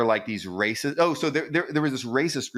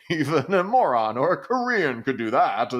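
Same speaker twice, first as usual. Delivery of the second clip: higher, louder, hammed-up sneering proverb; mock bigot saying; 5 semitones higher, 4 dB louder than usual. Quoting an old Japanese anti-Korean saying, mock sneer. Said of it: "this old saying in Japan".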